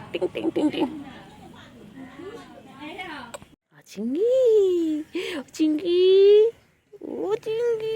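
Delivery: very high, loud sing-song calling; excited calling. Real, not acted. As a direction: call the pet in a soaring voice. (খুব উঁচু সুরে উচ্ছ্বসিত ডাক)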